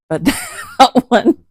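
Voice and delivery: slow words broken up by laughter; laughing. Real, not acted. Laughing at her own creepy voice to viewers. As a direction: laugh through it. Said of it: "that one was a little creepy".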